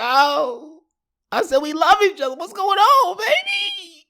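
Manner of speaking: very high, hammed-up whimpering plea; begging his ex in public, high whiny plea; high whining plea. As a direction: whine it like a lovesick kid. Pleading with girlfriend in public.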